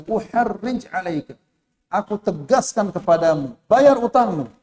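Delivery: loud, high insistent demand; insist, pay your debt now; harsh demand. Acted. Bedouin demanding his debt from the Prophet, harsh and loud.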